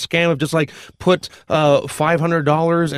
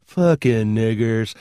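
muttered slur; 7 semitones lower, much slower than usual. Retold or imitated. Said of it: "he kinda looks at me and goes".

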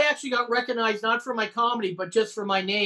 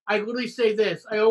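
ordinary talk, nothing marked

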